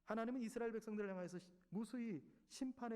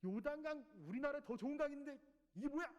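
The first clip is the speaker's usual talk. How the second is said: higher, indignant outburst; furious Naaman, voice raised and higher; angry general; 8 semitones higher, 3 dB louder than usual. Acted. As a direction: scoff at the river in anger. 나아만 장군 굉장히 화내거든요.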